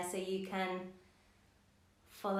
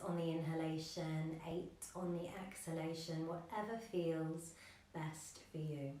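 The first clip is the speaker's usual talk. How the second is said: soft, slightly lowered yoga-teacher voice; guided meditation; 3 semitones lower, 6 dB quieter than usual. Acted. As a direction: guide them gently into the breath, with long pauses. Leads breathing meditation.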